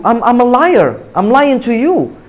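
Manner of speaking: ordinary talk, nothing marked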